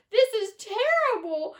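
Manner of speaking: high, loud wail. A narrator's reading. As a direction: wail it in despair. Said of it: "wailed Missus T V".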